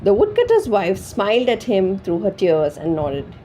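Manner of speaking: ordinary delivery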